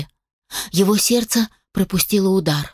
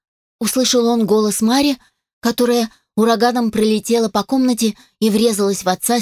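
usual speaking voice

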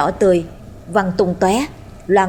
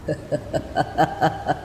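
hushed staccato cackle; low, muffled ghostly laugh; 4 semitones lower, 7 dB quieter than usual. A narrator's reading. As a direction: laugh dully like a spectre, low and voiceless. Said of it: "vang lên tiếng trầm đục".

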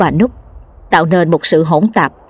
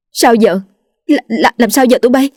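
higher-pitched, stammering panic; urgent; 5 semitones higher than usual. A narrator's reading. Hoa asks the young men what to do now, urgent and stammering. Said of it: "gấp gáp nói".

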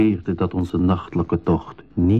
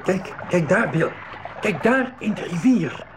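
much higher, eager cries; excited call to Bill at the river; excited pointing; 10 semitones higher than usual. Acted. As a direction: point it out, thrilled.

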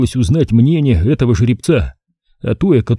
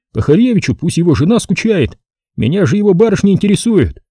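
higher, grinning captain; sly womanizer, pitched up; Savelyev's voice; 7 semitones higher than usual. A narrator's reading. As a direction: tease it out with a grin. Savelyev grins about girls.

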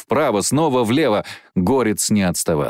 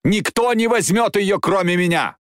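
high, impassioned shout; fierce, high-pitched outcry; shouted defiance; 10 semitones higher than usual. Acted. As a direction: shout it out possessively. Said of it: "крикнул он".

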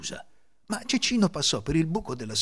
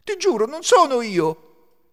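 high, loud, slow protest; pleading denial; 9 dB louder, slower than usual. A narrator's reading. Accused thief denying to his companion, alarmed pleading. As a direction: protest your innocence desperately.

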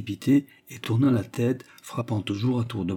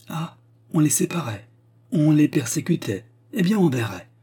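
agitated outburst; 6 semitones higher than usual. A narrator's reading. Hero's agitated inner outburst.

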